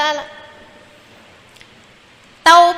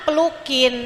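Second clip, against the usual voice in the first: ordinary delivery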